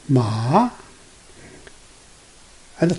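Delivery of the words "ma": long "Ma" climbing from low to high; second tone. Shown as a demonstration. Teacher showing the second tone, "Ma" rising. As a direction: slide "Ma" up from the bottom. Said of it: "Start from low to high".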